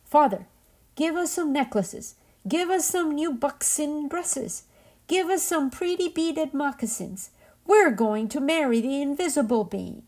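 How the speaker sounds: high, whiny demand. A narrator's reading. Spoiled daughters demanding gifts from their father, whiny.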